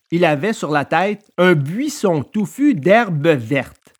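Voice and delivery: plain reading voice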